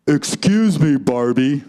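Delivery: a hint of a manly doll; lightly voiced masculine Ken. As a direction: play Ken with a slightly firm masculine voice. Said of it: "said Ken in his masculine voice".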